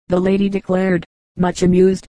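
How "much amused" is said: ordinary delivery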